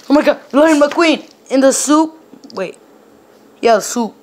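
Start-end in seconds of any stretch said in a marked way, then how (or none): none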